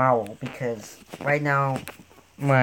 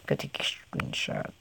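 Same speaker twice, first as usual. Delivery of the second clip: hushed whisper; 10 semitones lower, 10 dB quieter than usual. Real, not acted. Hushed aside.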